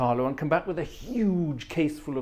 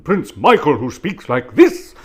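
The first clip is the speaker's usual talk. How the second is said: loud, slow royal Christopher Lee voice; Prince Michael; 11 dB louder, slower than usual. Retold or imitated. Listing royal voices, booming slow royal impression. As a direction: boom it out slowly like a regal Christopher Lee. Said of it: "basically Christopher Lee with, with a more royal voice".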